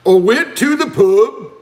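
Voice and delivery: exaggerated Scottish pub-goer impression; attempted Scottish accent. Shown as a demonstration. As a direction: have a go at a hammed-up Scottish accent. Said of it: "Let's have a little Scottish accent".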